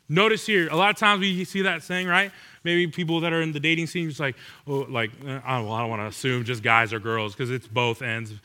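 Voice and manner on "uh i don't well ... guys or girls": usual speaking voice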